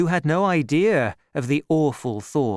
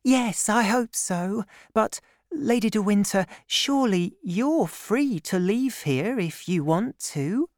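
put-on high, gentle girlish voice; young woman; 9 semitones higher than usual. A narrator's reading. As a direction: play Constance, shy and kind. Constance voiced by narrator.

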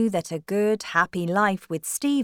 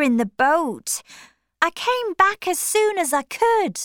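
very high, louder, desperate sister; crying out; 11 semitones higher, 5 dB louder than usual. A narrator's reading. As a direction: plead your innocence to your brother. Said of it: "cried Maggie".

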